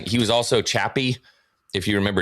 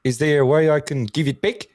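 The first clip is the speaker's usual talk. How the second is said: higher-pitched, gentle Māori Kiwi impression; imitated Māori New Zealand accent; 6 semitones higher, 3 dB louder than usual. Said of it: "I think he's Maori".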